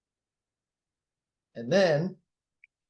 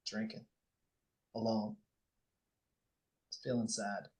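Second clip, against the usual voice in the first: soft guided reflection; 2 semitones lower, 5 dB quieter than usual. Acted. Coach softly replaying Eric's lonely evening to the group, hushed. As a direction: paint the lonely scene softly for them.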